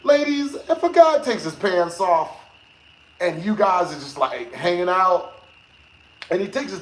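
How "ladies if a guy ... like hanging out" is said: usual speaking voice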